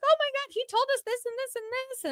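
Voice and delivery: high, loud gushing imitation; excited raving. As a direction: gush like an amazed fan. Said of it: "raving about him".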